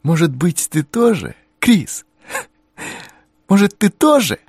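high, hopeful and bright; sudden glad hope; joyful bewilderment. A narrator's reading. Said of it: "радостного недоумения".

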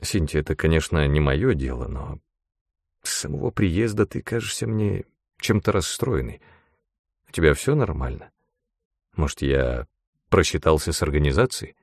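low, soft, halting inquiry; tentative, hushed and concerned; hesitant concern. A narrator's reading. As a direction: tread carefully into a private question. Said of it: "он немного помялся, но решился продолжить".

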